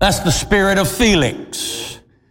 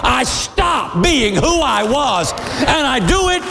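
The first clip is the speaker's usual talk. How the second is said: fiery preaching; 12 semitones higher than usual. Acted. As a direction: preach it full force to the crowd. Preaching at full force.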